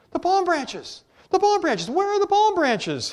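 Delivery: high, loud, indignant cry; shouted. A narrator's reading. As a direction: shout it out high, demanding an answer. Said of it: "he shouted".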